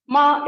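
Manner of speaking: MA held on a high level pitch. Shown as a demonstration. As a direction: keep MA level and high, no glide. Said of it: "ma with high level pitch".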